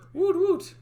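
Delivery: high, loud little cheer; excited cheer. Real, not acted. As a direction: whoop with delight. Cheering after hearing the take.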